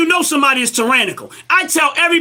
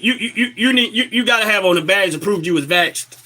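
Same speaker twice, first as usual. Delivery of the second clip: ordinary delivery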